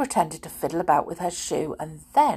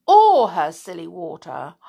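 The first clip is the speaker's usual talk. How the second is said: ordinary delivery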